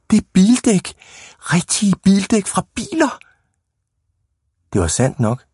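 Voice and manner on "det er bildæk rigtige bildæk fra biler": high, thrilled exclamation; excited cry. A narrator's reading. Boy shows friends real car tyres, high excited cry. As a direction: cry out the find, pitched way up. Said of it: "råbte Mattis".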